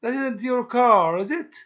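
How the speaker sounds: "is it" lifted high at the end; rising tag demo. Shown as a demonstration. Teacher modelling the tag question, rising at the end. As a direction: fall on car, then rise on is it. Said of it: "not the rising intonation in tag question".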